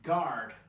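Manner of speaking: "guard" said gard, no U sound; "guard" with a hard G and silent U. Shown as a demonstration. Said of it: "but g".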